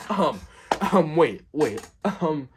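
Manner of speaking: ordinary delivery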